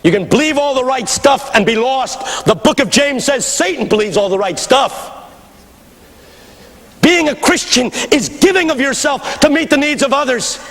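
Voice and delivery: high, ringing sermon delivery; full-force preaching. Real, not acted. Preacher challenging a congregation on sacrifice, forceful high preaching. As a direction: preach it hard, pitch held high.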